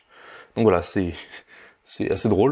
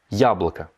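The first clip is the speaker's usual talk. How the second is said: яблоко with the first syllable я stressed. Shown as a demonstration. Teacher stressing я in яблоко for learners. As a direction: hit the я in яблоко. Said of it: "J'insiste avec ma voix sur la syllabe".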